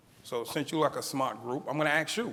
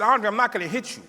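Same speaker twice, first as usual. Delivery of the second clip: teacher voice pitched up and louder; an imitated teacher, louder and higher; raised reassuring teacher; 6 semitones higher, 6 dB louder than usual. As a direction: insist loudly she won't hit him. Teacher reassuring frightened boy.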